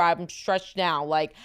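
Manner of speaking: ordinary talk, nothing marked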